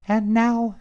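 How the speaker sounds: high, bright fairy-tale princess; cartoon princess. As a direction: announce it like a storybook princess. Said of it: "said the princess".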